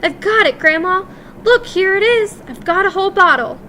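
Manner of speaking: high, loud triumphant cry; excited cry. A narrator's reading. Boy-mouse crying out in triumph to his grandmother. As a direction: burst out with the victory.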